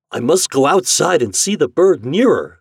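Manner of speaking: high, loud, lilting eagerness; eager man. Acted. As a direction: announce it with excited curiosity. Man eager to see bird.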